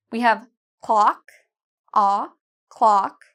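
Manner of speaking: clock with a big open ah, jaw dropped; wide open ah in clock. Shown as a demonstration. Teacher modelling clock for learners, jaw wide open. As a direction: stretch the ah in clock. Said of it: "notice how big my mouth gets".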